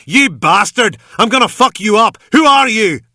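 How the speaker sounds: high, very loud furious yelling; ranting scream. A narrator's reading. Gunman screaming and ranting at the man he pinned. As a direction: rant and scream in a blind rage. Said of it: "a blur of screaming and ranting".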